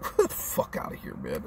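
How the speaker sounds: breathy whispered brush-off, just air; mock dismissive whisper. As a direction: hiss it out like waving someone away. I'm like.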